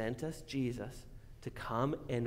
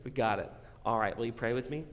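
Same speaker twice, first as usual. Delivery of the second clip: ordinary talk, nothing marked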